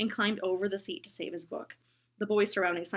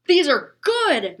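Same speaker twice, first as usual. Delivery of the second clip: loud, slow, higher bellow; 8 semitones higher, 18 dB louder, much slower than usual. Acted. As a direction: boom it out with delight. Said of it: "Mister Bruce bellowed".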